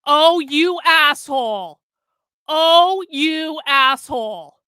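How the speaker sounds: slow, loud furious curse; real angry shout. Real, not acted. Streamer cursing the influencer who took his clip, slow angry shout. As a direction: spit it out slowly in real anger. Sees the stolen clip online.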